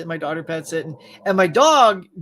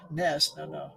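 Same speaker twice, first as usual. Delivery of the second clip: ordinary delivery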